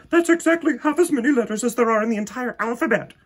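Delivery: put-on high, loud and fast bossy girl; know-it-all girl. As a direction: rattle it off smugly. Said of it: "Victoria explained".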